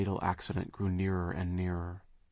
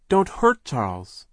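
high, loud, slow appeal; raised-voice plea; 6 semitones higher, 9 dB louder, slower than usual. A narrator's reading. Baby sister's plea for Charles, loud and high. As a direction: plead loudly on his behalf. Translation of Sunny's shrieked plea.

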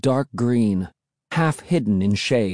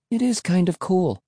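higher-pitched, mild admission; admitting; 7 semitones higher than usual. A narrator's reading. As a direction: concede it lightly. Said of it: "I admitted".

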